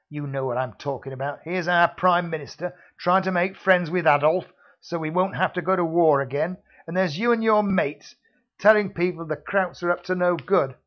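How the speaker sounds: loud scornful security man; menacing thug. Acted. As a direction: berate him like a heavy. Villain lectures hero.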